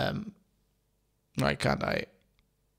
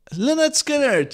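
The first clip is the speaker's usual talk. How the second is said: higher and louder, drawn out as the name finally comes; relieved eureka; 11 semitones higher, 5 dB louder than usual. Real, not acted. Host finally recalls the band for listeners, triumphant. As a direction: blurt the name like you just found it.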